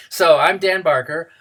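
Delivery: ordinary delivery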